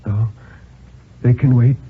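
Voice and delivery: plain reading voice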